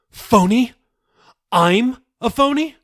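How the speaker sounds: high, loud incredulous echo; outraged disbelief. A narrator's reading. Daughter throws dad's word back, high and loud.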